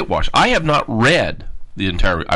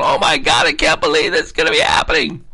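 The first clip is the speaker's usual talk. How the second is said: mocking panicked lawbreakers, voice higher and shouting; 7 semitones higher than usual. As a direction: ham up their disbelief, last line whispered high. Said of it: "They're going".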